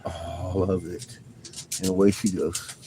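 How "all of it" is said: tender softer repeat. Real, not acted. Tender words to the dog.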